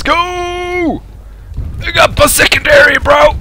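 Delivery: very high, drawn-out squeal; excited cheering. Real, not acted. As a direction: hype it up in a high voice. Excited mid-game.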